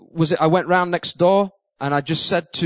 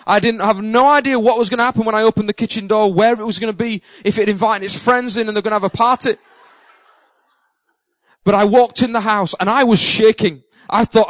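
ordinary talk, nothing marked

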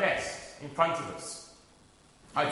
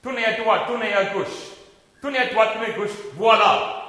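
put-on teacher rattles off directions in a louder, brisk French accent; rapid French teacher; 5 semitones higher, 6 dB louder than usual. French teacher confusing a scared pupil with fast directions, loud French accent. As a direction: bark the turns at him like a French teacher, quick and loud. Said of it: "but in French".

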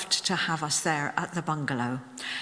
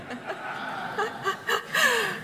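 real laugh; 14 semitones higher than usual. Real, not acted. Laughs at own joke.

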